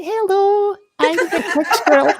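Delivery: cartoon Scottish squirrel, high and loud; high-pitched Scottish squirrel. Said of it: "very high-pitched, really high-pitched Scottish voice".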